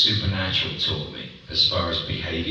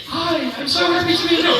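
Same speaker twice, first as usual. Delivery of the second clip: much higher, bright and chipper; high chirpy voice; 4 dB louder than usual. Retold or imitated. Mimicking a high-voiced panelist gushing hello. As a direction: pop up into a squeaky, eager greeting. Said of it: "And then".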